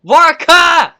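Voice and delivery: loud, delighted shout; excited yelling. Real, not acted. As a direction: yell it out, thrilled. Laughing excitedly at the character.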